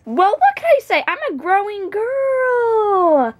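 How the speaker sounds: high, sing-out excuse, last word stretched; hammed-up innocent self-defence; playfully defensive. Teased eater defends herself playfully.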